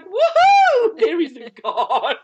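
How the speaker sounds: telling the latecomers she beat them, whooping and laughing; gleeful whoop. Retold or imitated. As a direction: crow with delight, laughing. Retold triumphant arrival.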